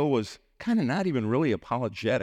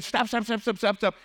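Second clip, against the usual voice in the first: imitating frantic protest, much higher; urgent interruption; 12 semitones higher than usual. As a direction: cut in with rapid-fire, high-pitched alarm. Boss cuts off the imitation.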